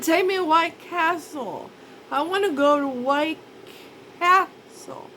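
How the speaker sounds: put-on high, slow childish plea; whiny stoned demand. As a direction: whine it like a loopy kid.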